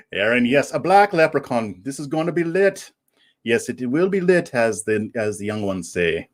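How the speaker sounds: put-on Irish leprechaun brogue; fake Irish accent. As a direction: answer playfully in a mock Irish accent. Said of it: "I can drop this accent".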